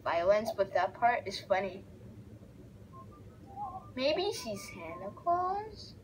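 ordinary talk, nothing marked

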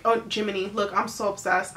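ordinary delivery

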